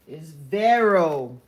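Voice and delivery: "Vero" loud and drawn out; emphatic correction. Real, not acted. Reader correcting a misheard name, "Vero" loud and stretched. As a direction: punch "Vero" slowly and loudly, with a clear R.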